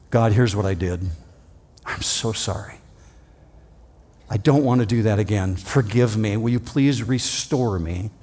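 soft, low, sorry prayer; repentant plea. Acted. A sinner asking God to restore him, softly pleading. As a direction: beg God gently for forgiveness.

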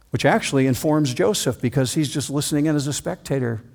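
usual speaking voice

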